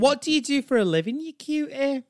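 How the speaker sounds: loud, high Yorkshire flirting; pushy Yorkshire woman cooing at a stranger; shrieky-high broad Yorkshire chat-up; Brenda. Acted. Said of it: "she has such a strong Yorkshire accent".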